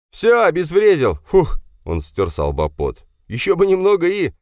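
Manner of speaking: high, loud breathless relief; relieved young man. Acted. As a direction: blow out with relief. Roma defuses bomb, relieved. (Рома с облегчением кричит, обезвредив бомбу)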